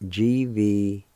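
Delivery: letters named slowly one by one; spelled out letters. Shown as a demonstration. Teacher spelling the word for the class, letter by letter. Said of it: "You spell like this".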